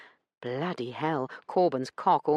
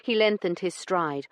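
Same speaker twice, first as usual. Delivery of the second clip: usual speaking voice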